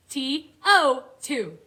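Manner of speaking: T O two, each letter slow and apart; slow letter spelling. Shown as a demonstration. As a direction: spell it out slowly for kids. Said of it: "Let's spell it".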